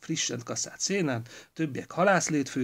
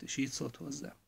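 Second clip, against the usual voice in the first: plain reading voice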